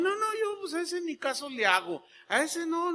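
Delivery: high mock of a believer dismissing Satan; dismissive believer. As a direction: send up a careless believer. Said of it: "O también".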